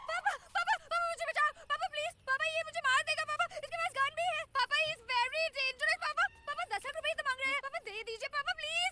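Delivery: high, loud cries for rescue; hammed-up terrified hostage begging; staged panicked pleading. Fake hostage begging her papa to save her, panicked. Fakes hostage pleas to father.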